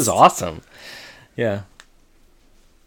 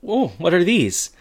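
higher, drawn-out pleased query; curious discovery; 6 semitones higher, slower than usual. Retold or imitated. Jack to wife, eating something he found, curious. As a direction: ask with lilting, slow curiosity. Eats something, asks about it.

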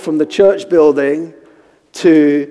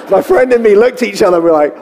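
laughing through the telling, voice rising; 4 semitones higher, 4 dB louder, faster than usual. Real, not acted. Preacher recalls him and his friend outside, laughing. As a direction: tell it through giggles. Laughs while retelling the moment.

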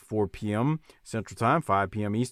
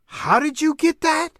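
incredulous; 4 dB louder than usual. Retold or imitated. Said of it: "I was like".

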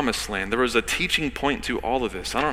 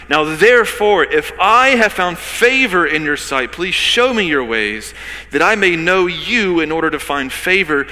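a touch of earnest pleading, louder; preacher voicing Moses begging God; 6 semitones higher, 5 dB louder than usual. Moses begs God to come along, raised pleading. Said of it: "Moses is pleading with God".

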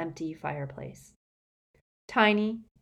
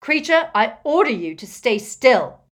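loud, forceful command; bellowing; 6 semitones higher, 10 dB louder than usual. A narrator's reading. Harry orders the frantic elf still, bellowing. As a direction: bellow it to stop a frenzy. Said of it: "Harry bellowed louder than both of them".

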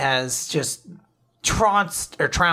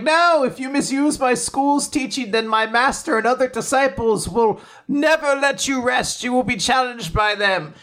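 a hint of a stern school teacher, pitched up; lightly voiced stern teacher; 8 semitones higher than usual. Teacher warning Fang, a lightly voiced stern tone. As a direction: warn him off with mock gravity. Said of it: "the school teacher is like".

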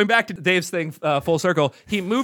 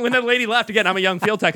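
ordinary talk, nothing marked

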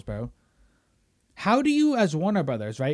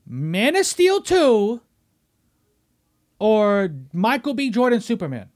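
loud, high, slow, every word hammered; drawn-out indignant demand; emphatic loud question; 6 semitones higher, 7 dB louder, slower than usual. Real, not acted. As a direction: spell out the choice like it's obvious. Posing the choice emphatically.

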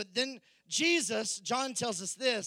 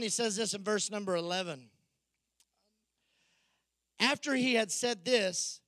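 ordinary talk

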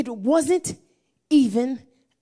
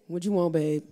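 husband's voice; 8 semitones lower, 7 dB quieter than usual. Retold or imitated. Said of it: "And then he says".

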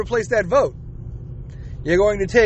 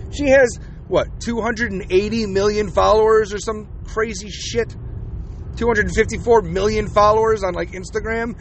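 ordinary talk

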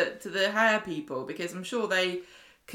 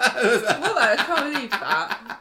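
louder, higher, drawn out through laughter; laughing disbelief; 4 semitones higher, 5 dB louder, much slower than usual. Real, not acted. Shocked by the robot's word, laughing disbelief. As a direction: crack up in real shock.

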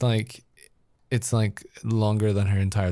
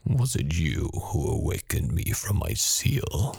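slow whispered demon voice; slow breathy menacing whisper; 5 semitones lower than usual. Acted. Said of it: "That's good, [name]".

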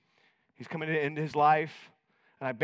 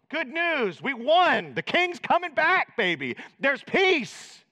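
jubilant messenger; 9 semitones higher, 4 dB louder, slower than usual. Retold or imitated. Messenger announcing victory.

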